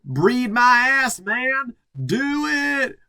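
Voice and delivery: high, loud, slow mock passion; hammed-up ecstatic yell. Chris yelling at Matt during sex, hammed-up high cry. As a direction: overact a desperate lover.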